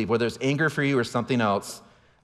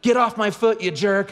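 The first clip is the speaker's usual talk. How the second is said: higher mock yell of an angry girl; daughter yelling; 9 semitones higher, 3 dB louder than usual. Daughter snapping at her brother, higher mock yell. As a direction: shout it up high like a cross kid. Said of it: "she yells".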